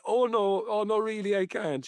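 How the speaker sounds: high, politely flustered Cork gent; polite refusal. Retold or imitated. Bill O'Herlihy turning down the cabbie, mock polite. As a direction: decline him sweetly, over-polite. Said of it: "so polite".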